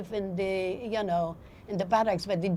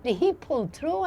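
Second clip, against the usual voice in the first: ordinary talk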